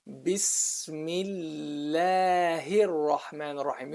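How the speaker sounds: slow, drawn-out "Bismillahir" with thin "læ"; light-lam recitation. Shown as a demonstration. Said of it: "So you say".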